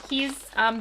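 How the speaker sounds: ordinary talk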